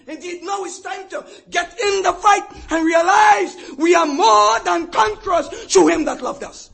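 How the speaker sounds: loud, rising pulpit preaching; full-force preaching. Acted. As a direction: thunder it out to the congregation.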